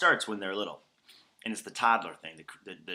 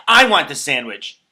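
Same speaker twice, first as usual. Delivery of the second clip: loud, slow whiny demand, much louder; hammed-up sandwich grab; mock grabby demand; 6 semitones higher, 17 dB louder than usual. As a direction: belt it out like a grabby toddler. Adult grabbing friend's sandwich, acted.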